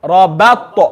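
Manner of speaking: Rabat, smiled vowel on ba, bounced t; smiley ba. Shown as a demonstration. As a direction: spread the lips for the ba in Rabat. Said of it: "make sure you smile for the ba".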